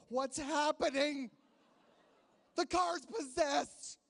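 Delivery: high, slow, hammed-up panic; mock panic. Tourist fake-panicking as the car rolls uphill. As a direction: drag it out in fake terror.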